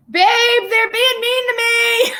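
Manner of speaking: mock whine. Whining to partner for sympathy.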